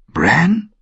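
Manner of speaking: high, rising, voiced mock whisper; questioning hushed call. A narrator's reading. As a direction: call the name as a searching question, pitch sweeping up. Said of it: "flüsterte eine Stimme". (fragendes, hohes Rufen mit steigender Stimme)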